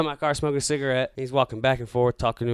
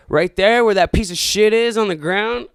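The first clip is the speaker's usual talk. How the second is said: higher, louder pointed yell; angry retort; 8 semitones higher, 7 dB louder than usual. Retold or imitated. Driver arguing with the passenger, higher louder yell. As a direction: shout it, pointing at the ground.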